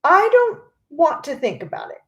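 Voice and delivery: shrieked. A narrator's reading. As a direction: shriek it to push the thought away. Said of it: "shrieked Missus Gloop".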